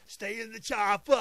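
put-on loud slow action-hero accent, laughing; Arnold. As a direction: bark it like a muscleman movie star. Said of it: "scene three of Hercules in New York".

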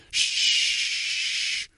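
drawn-out serpent hiss; the preacher imitates a serpent hissing; snake hiss. Shown as a demonstration. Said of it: "to simulate the sound of a snake".

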